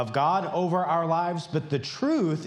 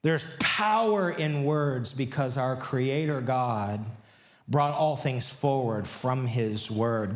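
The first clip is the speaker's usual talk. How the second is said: usual speaking voice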